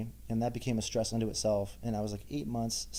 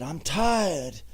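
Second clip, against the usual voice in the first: put-on high, loud, slurry drunk whine; a drunk caught on a stranger's couch, whining loudly; drunk sleepy reply; 7 semitones higher, 7 dB louder than usual.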